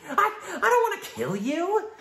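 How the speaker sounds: high, loud, manic Joker cackle; manic laugh. Acted. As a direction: burst out laughing madly. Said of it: "the Joker".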